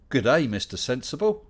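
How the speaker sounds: hammed-up Aussie "good day"; light Australian impression read out cheerfully; Australian accent. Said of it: "Sorry about the accent".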